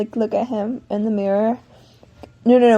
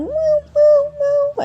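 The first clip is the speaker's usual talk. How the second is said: very high, thin mewing; long high-pitched mews; imitated pet whine; 3 dB louder, much slower than usual. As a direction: mimic a whining cat far up high. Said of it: "he's, like, whining again".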